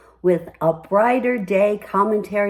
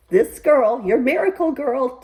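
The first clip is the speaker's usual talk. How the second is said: ordinary delivery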